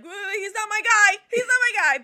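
loud, high-pitched mock outcry; mock alarmed protest. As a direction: blurt it out in exaggerated protest. Acting out own panicked objection.

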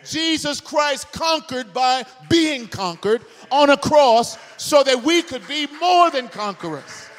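rising, loud, high preacher's cry; preaching at full force. Acted. Pastor proclaiming the cross to his congregation, shouting. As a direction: build it up and proclaim it to the room.

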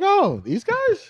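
high, slow, wondering voice; puzzled kid voice. Retold or imitated. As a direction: sound like a baffled future kid. Future kids voiced.

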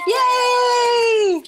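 high, loud, drawn-out yay; excited cheer. Real, not acted. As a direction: cheer at the top of your voice. Cheering once the connection works.

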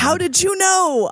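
high-pitched, acted astonishment; surprised question. A narrator's reading. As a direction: ask it in hammed-up surprise. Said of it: "I asked in a surprised way".

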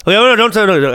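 normal talking voice

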